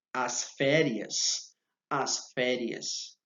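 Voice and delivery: drawn out for students to copy; slow model repeat. Shown as a demonstration. Teacher drills the class with a slow model word. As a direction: say "As férias" slowly, stretching each syllable. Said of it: "slow repetition for learners".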